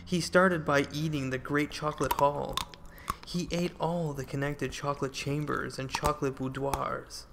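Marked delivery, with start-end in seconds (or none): none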